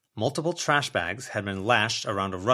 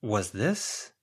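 usual speaking voice

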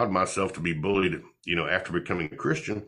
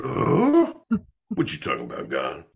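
startled protest; 3 semitones lower, 3 dB louder than usual. Retold or imitated. Startled reply to God.

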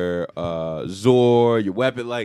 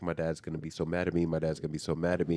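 hesitant worry; 4 semitones lower, 7 dB quieter, faster than usual. Retold or imitated. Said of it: "she's kinda, like, hesitant".

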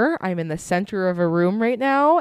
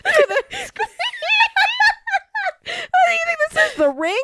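high, fast squealing through laughs; hysterical laughing; 3 dB louder, much faster than usual. Real, not acted. Startled friend squealing at the other through laughter.

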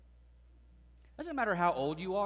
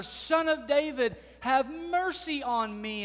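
very loud, high cry for mercy, slow at the end; even louder cry; 10 semitones higher, 9 dB louder than usual. Retold or imitated. Blind man crying out even louder to Jesus, loud high plea. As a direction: shout for mercy with everything you have. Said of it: "even louder".

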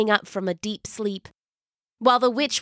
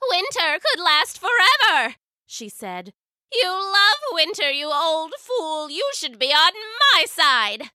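scheming witch voice; 8 semitones higher than usual. Acted. Witch tempts the wizard.

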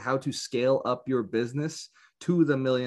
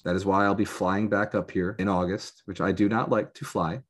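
ordinary talk, nothing marked

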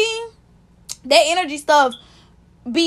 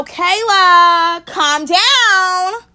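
imitated nurse, loud, higher, drawn-out commands; nagging nurse; 5 semitones higher, 5 dB louder, much slower than usual. Nurse telling screaming Kayla to calm down, insistent. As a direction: call over the patient, higher and louder. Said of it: "the nurse just kept saying".

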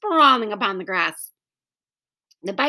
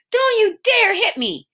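alarmed protest; 11 semitones higher, 4 dB louder than usual. Acted. Scooter threatened with umbrella.

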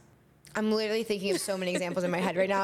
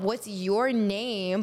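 slow sincere question; much slower than usual. Shown as a demonstration.

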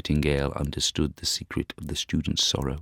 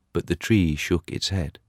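ordinary delivery, nothing marked

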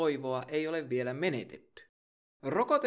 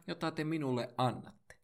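usual speaking voice

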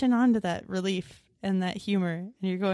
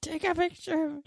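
imitating eager fan; 9 semitones higher than usual. Mock celebrity photo request.

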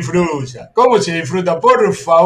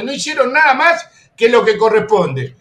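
usual speaking voice

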